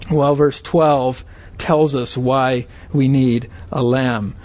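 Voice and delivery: usual speaking voice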